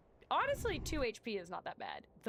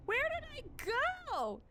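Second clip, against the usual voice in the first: very high mock wail at full voice. Player lost after a fall, high mock wail. Same cry repeated, much louder.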